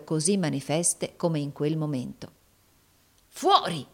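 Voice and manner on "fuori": furious one-word order; enraged dismissal, teeth grinding; through gritted teeth. A narrator's reading. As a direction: spit it out through clenched teeth. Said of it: "digrignando letteralmente i denti".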